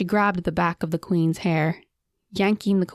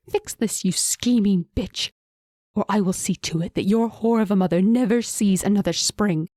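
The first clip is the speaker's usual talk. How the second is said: shrieking threat; 2 semitones higher than usual. A narrator's reading. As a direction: spit the threat at her. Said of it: "Queen Hyle shrieked".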